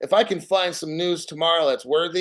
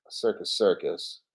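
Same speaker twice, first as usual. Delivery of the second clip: whisper; 9 semitones lower, 5 dB quieter than usual. Real, not acted. Naming cheap hotel.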